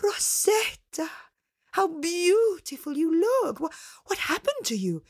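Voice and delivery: mother's high-pitched delighted surprise; astonished cry. Acted. Mother seeing Rosetta in the pretty dress, astonished. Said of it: "she cried".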